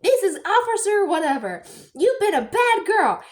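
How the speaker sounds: high, loud mock cop voice; a boy playing cop arresting her, high and loud; kid playing cop.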